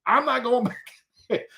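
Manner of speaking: very high, agitated retelling; comic panic. Retold or imitated. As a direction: squeal it in mock alarm, breaking into a laugh. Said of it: "And I went like this".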